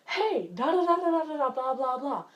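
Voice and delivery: high, loud mock chatter; chattering relatives. Family barging into the bedroom, mock chattering loudly. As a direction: babble brightly in imitation of intruding relatives. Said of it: "they come in and they're like".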